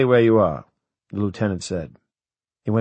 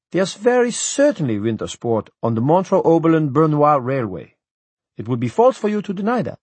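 high, louder, offended Swiss official; indignant official; 7 semitones higher, 4 dB louder than usual. A narrator's reading. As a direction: bristle at the insult to his town.

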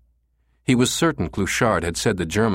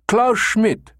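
put-on very high, loud German-accented blurt; 5 dB louder than usual. A narrator's reading. Caller makes up his brother's name, blurted German accent. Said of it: "Becker blurted a name".

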